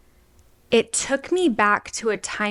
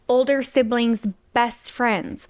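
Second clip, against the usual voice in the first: normal talking voice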